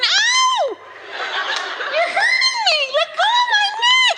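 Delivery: shrill high screaming woman; hammed-up high pitched lady's scream. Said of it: "she's going".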